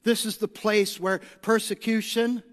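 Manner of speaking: normal talking voice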